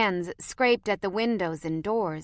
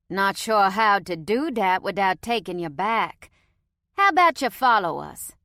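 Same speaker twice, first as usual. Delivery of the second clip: louder Cajun invitation; casual Cajun offer; Cajun accent; 6 dB louder than usual. A narrator's reading. Cajun scout invites them to follow in Cajun accent. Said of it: "in a heavy Cajun accent".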